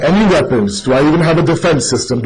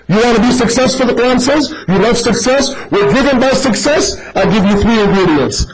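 ordinary delivery

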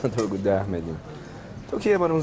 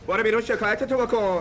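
sharp, much higher shout of dismissal; angry retort; 10 semitones higher than usual. Acted. Father telling Ganji to go file his complaint, angry. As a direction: throw him out with a raised pitch. Heated land dispute argument.

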